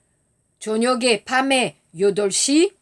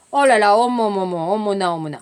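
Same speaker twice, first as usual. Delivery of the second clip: dismay; 4 semitones lower, faster than usual. Acted. Jam spilled at breakfast.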